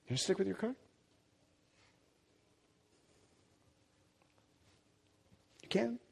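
very soft, pointed challenge; hushed challenge. Real, not acted. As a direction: drop right down and leave it with them. Hushed challenge after shouting.

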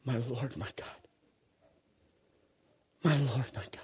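low, soft and hushed; a quiet, reverent confession; reverent awe. Retold or imitated. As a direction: whisper it in awe. Thomas worshipping.

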